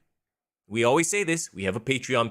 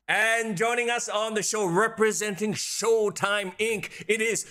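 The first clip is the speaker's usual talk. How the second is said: announcer voice; 6 semitones higher, slower than usual. Acted. Announcing the guest dramatically.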